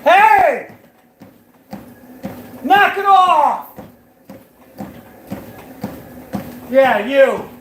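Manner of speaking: high, loud scolding shout at the dogs; real yelling. Real, not acted. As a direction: yell to break up a scuffle in another room. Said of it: "Sorry for yelling".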